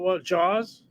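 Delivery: ordinary talk, nothing marked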